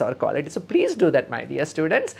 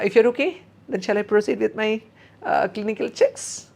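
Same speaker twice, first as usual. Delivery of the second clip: polite nurse; 7 semitones higher than usual. Shown as a demonstration. Nurse asking permission.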